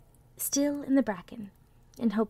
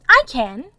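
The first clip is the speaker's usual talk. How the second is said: insistent little rabbit; 4 semitones higher, 13 dB louder than usual. A narrator's reading. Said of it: "said the little rabbit".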